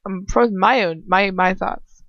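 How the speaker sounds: ordinary talk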